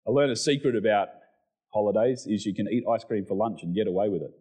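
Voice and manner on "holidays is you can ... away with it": usual speaking voice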